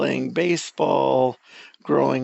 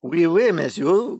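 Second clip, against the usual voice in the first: mock French accent; 3 dB louder than usual.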